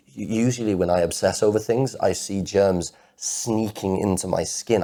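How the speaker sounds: loud, impassioned outpouring; anguished protest. Acted. Man protesting that love isn't a mistake, anguished and loud.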